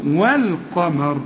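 slow والقمر with lam sounded; clear moon lam. Shown as a demonstration. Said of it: "علامتها السكون".

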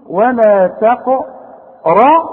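ولا تقربا slowly, syllable by syllable, thick ra; heavy ra, light ba. Shown as a demonstration. Quran teacher demonstrates delivery of ولا تقربا, heavy ra, light ba. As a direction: spell out تقربا with heavy qo-ra and thin ba. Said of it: "هفخم الراء".

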